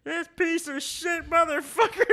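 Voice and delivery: high, loud imitation of a furious rant; hammed-up livid cursing in a put-on voice. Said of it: "he just goes livid".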